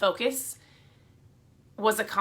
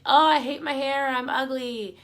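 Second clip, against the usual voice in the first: higher, louder mock whine of a young girl; imitating a daughter; 5 semitones higher, 5 dB louder than usual. A mother imitating her daughter's higher, louder mock whine. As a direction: play the sulky daughter, lightly mocked. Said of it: "My girls will say".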